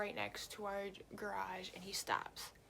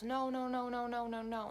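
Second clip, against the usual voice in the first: imitating drunk stranger; 4 semitones higher, 5 dB louder than usual. Drunk stranger refusing to leave.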